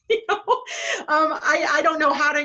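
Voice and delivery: fighting back real tears; laughing through tears. Real, not acted. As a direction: voice catching between a laugh and a sob. Tearful while telling a moving story.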